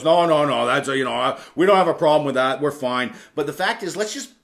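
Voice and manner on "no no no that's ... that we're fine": louder, hammed-up insistence that all is fine; defensive denial. The wall of denial answering mental health talk, louder defensive insistence. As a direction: push back hard, brushing it off.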